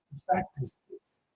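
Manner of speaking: quiet. Shown as a demonstration. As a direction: go quiet and just state it. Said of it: "you get quiet".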